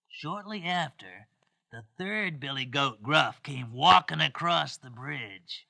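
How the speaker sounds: usual speaking voice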